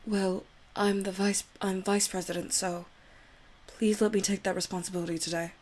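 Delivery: put-on soft, timid girl begging forgiveness; shy Yuri voice. Yuri begs Ari not to hate her, timid and pleading. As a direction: plead with him, nervous and apologetic.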